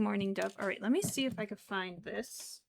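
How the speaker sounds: usual speaking voice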